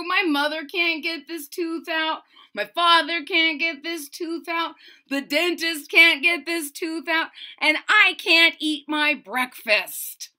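put-on whiny kid. Andrew listing who couldn't pull his tooth, whiny. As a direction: build the list of failures, whining.

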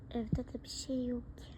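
a soft whisper to herself; whispered worry. Acted. Worried parent whispers to herself about the empty house. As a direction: breathe it out, worried and hushed.

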